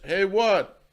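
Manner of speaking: a hint of a drunk challenging; drunk man's retort. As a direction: snap back like a drunk. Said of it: "the drunk man goes".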